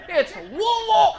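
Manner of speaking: loud, high, slow angry correction; angry local pronunciation. Retold or imitated. Angry crowd correcting the comic's pronunciation, loud and high. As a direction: bark the city name like locals do. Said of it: "It was an angry".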